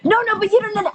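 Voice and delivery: high-pitched, insistent fretting; mock worrying. Worrier to reassuring friend about lockdown, anxiously insistent. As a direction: imitate a worrier refusing to be soothed.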